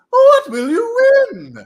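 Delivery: high, loud, slow hype yell; excited shout. Pretend. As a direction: scream it like a game show host.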